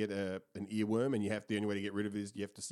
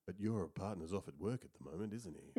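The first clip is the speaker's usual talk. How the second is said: low, soft dedication-show host; patronizing radio host; 4 semitones lower, 7 dB quieter than usual. Acted. Host to love-song dedication caller, low and patronizing. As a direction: soothe the caller in mock-sincere tones. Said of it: "that guy's voice was so ... patronizing".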